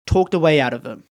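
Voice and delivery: usual speaking voice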